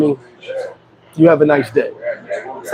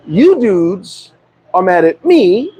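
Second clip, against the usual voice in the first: ordinary talk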